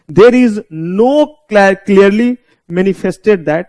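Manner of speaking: slow, word-by-word reading of the legal text; slow dictation. Shown as a demonstration. Teacher dictating the statute to students, slow and deliberate. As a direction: read it out slowly so they can write it down.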